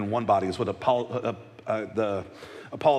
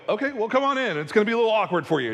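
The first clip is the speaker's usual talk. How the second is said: awkward welcome; 7 semitones higher, faster than usual. Retold or imitated. Congregation greets outsider.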